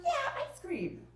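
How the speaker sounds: very high, drawn-out cheer; matched excitement. Acted. Mom as excited as the kids, cheering. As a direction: echo the kid's glee, stretching it out. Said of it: "mom is just as excited as the kids".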